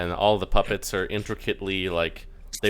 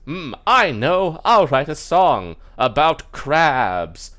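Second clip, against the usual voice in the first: high, loud, stagey sing-song; slow, drawn-out bright idea, theatrically voiced; lute-player puppet; 7 semitones higher, 8 dB louder, much slower than usual. Lute player decides to write a song, stagey.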